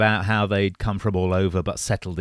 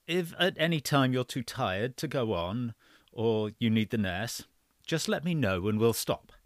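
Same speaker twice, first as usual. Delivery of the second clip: story narration; 9 dB quieter than usual. Acted. Reads story aloud.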